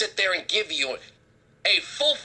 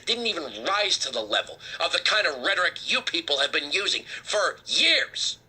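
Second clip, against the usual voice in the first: usual speaking voice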